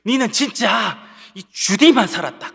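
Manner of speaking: angry father. Retold or imitated. Father fed up with his argumentative son, imitated angry scolding. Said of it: "막 화가 나서".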